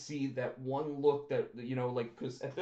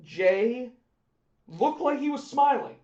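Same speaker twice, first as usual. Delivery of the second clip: rising amused outrage about the smile; high excited mockery; 7 semitones higher, 7 dB louder, slower than usual. Real, not acted. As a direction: voice jumps much higher and louder. Mocking Jay's expression.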